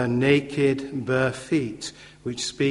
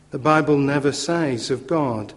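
normal talking voice

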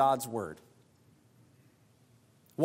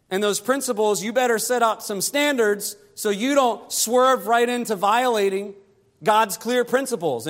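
high, loud exhortation from the pulpit; impassioned preaching; 6 semitones higher, 4 dB louder than usual. Acted. Preacher warning singles who set up no fences, impassioned preaching. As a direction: drive each point home, pitched up and loud. Preacher presses the point at full force.

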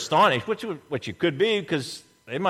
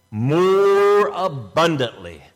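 "more" hugely drawn out and punched; stress on more; 4 dB louder, much slower than usual. Shown as a demonstration. Preacher quoting Jesus on abundant life, stretched stress on "more".

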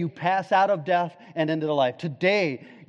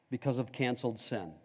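hushed repeat; 5 semitones lower, 10 dB quieter, faster than usual. Shown as a demonstration. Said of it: "repeated point, hushed".

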